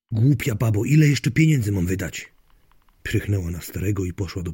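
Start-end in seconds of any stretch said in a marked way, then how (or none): 0.1-2.3 mildly angry, louder retort; exasperated husband. Acted. Husband complains to wife about spending money, exasperated. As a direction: argue back with raised voice.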